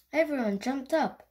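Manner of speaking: ordinary delivery, nothing marked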